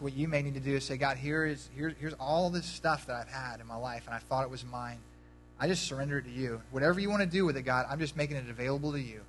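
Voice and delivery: soft, low prayer voice; hushed closing prayer. Acted. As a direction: lead the room quietly into prayer. Pastor leads prayer.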